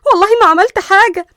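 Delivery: very loud, high-pitched alarm; panicked high cry of innocence; startled panic. Acted. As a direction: jolt awake shouting in fright. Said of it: "فتحت ليندا عيونها بفزع".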